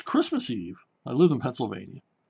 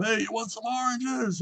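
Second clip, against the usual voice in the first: mock holler up to a ship's deck; retold hawker's yell; 9 semitones higher, 3 dB quieter than usual. Drunk sailors selling oranges up to a battleship, imitated yell. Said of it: "and they're yelling up".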